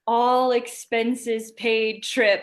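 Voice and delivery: ALL EXPENSES PAID drawn out slowly; savoring the words. Retold or imitated. Guest quoting what lured her to Mexico, savoring the words.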